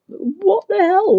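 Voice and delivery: high, slow, incredulous cry; what the hell. Real, not acted. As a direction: squeal it in shocked disbelief. Outraged reaction to Karen story.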